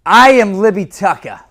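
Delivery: brash bravado. Shown as a demonstration. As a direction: own the whole room. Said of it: "I'm gonna walk you right through this".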